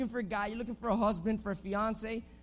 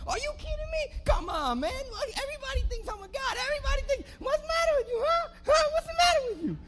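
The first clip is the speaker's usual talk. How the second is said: very high, squawked mock tirade; hammed-up outburst; 4 dB louder than usual. Friend asked for a coin blows up, high mock outrage. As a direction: overreact wildly in a high-pitched voice. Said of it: "your friend all of a sudden starts, like, going".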